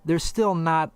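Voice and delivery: normal talking voice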